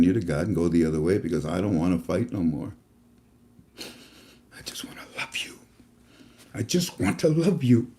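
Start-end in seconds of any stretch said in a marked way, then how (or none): 3.8-5.6 hushed breathy whisper, almost no voice. Acted. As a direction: breathe it to them like a secret. Tender repeated line, whispered.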